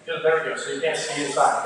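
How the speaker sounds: ordinary talk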